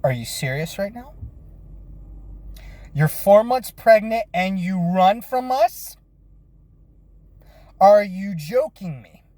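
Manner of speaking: mild disbelief. Retold or imitated. Learning runaway is pregnant.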